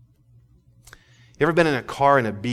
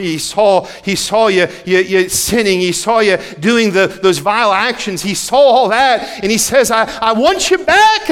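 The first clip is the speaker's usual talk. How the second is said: starts level, climbs higher and louder to a pleading peak; building sermon; 6 semitones higher, 7 dB louder than usual. Acted. Preacher telling the congregation God saw their sin, rising to full force. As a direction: build from steady telling to a ringing cry.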